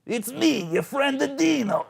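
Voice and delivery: high-pitched, loud fake friend; Adino's impostor. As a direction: pass yourself off badly as a pal. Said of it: "they're not so good at doing voices".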